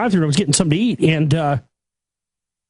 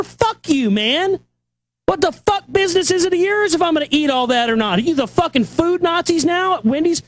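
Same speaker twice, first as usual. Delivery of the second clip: angry shout; 7 semitones higher than usual. Retold or imitated. Retelling his outburst at the drive-thru worker, furious yelling. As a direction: tear into the worker with outrage. Speaker's angry retort retold.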